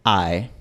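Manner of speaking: ae con final abierto, como la E de kit; diptongo ae inglés. Shown as a demonstration. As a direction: deslizar de a hacia una E abierta. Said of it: "diptongo inglés demostrado". (ae pronunciado con final abierto)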